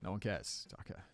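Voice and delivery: low, soft, flat put-down; deadpan dismissal. Real, not acted. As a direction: drop it dry and quiet.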